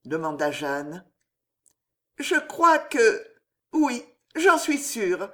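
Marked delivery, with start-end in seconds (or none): 2.2-5.3 high, slow, halting stammer. A narrator's reading. Loïse confessing to her mother her love, stammered high and slow. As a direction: falter shyly over each word. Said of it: "balbutia Loïse".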